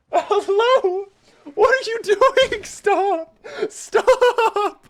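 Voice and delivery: high falsetto shrieks, laughing and crying out; panicked shrieking. Real, not acted. Streamer whose game car is being rammed, panicked high shrieks. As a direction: squeal in mock terror, half laughing.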